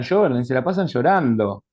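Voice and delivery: usual speaking voice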